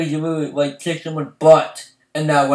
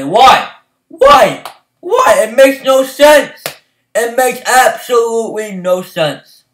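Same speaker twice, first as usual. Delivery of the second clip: rising, louder and louder why; exasperated; 4 semitones higher, 3 dB louder, slower than usual. Real, not acted. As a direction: beg for an answer, exasperated. Frustrated fan repeating why.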